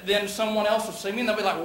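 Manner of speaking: ordinary delivery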